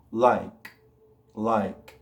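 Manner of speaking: "like" with a released, airy K; low "like", ending on a puff of air; no vibration. Shown as a demonstration. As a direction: pop the final K of "like" without voice. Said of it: "No hay vibración".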